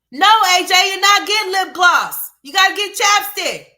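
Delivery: high, loud mocking mom voice; over-the-top imitation of a nagging mom; mock shrill mother. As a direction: parody a screeching mother. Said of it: "When she speaks".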